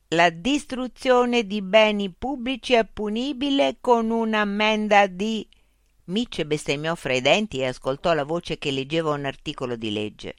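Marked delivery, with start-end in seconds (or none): 0.1-5.5 high, loud, slow loudspeaker machine. Acted. Loudspeaker robot voice addressing Mitch. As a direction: speak as a polite, mechanical information unit.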